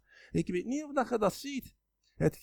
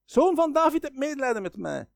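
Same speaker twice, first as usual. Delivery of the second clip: blind beggar calling out loudly, higher pitch; 6 semitones higher, 6 dB louder than usual. Retold or imitated. Blind beggar calls to Jesus from roadside, loud plea. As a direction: cry out to be heard by the passing crowd. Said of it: "En hij begint te roepen".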